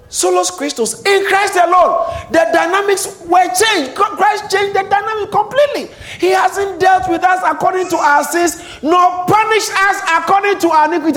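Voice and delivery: loud, high, fervent preaching; preaching at full force. Acted. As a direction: shout it from the pulpit. Preaching climax.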